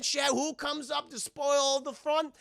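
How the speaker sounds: ordinary talk, nothing marked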